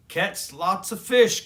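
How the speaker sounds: calling out. Acted. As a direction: call it across the water. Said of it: "called the cubs".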